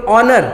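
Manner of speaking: ऑनर said as "onar", no h sound; silent h. Shown as a demonstration. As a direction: start ऑनर straight on the open o. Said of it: "ऑनर में भी अ sound आ रहा है".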